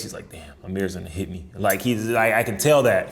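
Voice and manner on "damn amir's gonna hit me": low, quiet acted-out inner thought; soft, low mock worry; imagined worried batter. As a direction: voice the batter's dread under your breath. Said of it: "I can see a look on his face".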